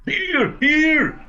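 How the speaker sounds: high shouted call; a muffled voice calling from the sack; a captive shouting from inside the sack. Acted.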